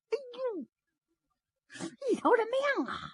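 high, thin, hushed wail of shock; horrified cry. Acted. Killer sees the dead man at his feet, horrified high cry. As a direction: gasp it out high and quiet, aghast.

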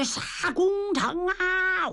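high, drawn-out war cry; battle cry. Acted. Niu Gao raising his spear to storm the city, slow high battle cry. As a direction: stretch the charge call out slow and high.

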